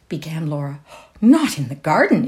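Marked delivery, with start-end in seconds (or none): 1.2-2.3 higher, louder cut-in; startled mother interrupting; alarmed interruption. A narrator's reading. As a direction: break in with sudden alarm. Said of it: "interrupted her mother".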